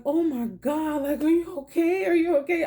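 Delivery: retelling an attendant's alarmed concern. Retold or imitated. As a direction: imitate a shocked, fussing stranger.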